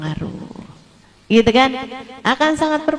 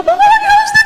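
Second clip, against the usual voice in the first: mock fan scream; 4 dB louder than usual. Imitating screaming fan girls.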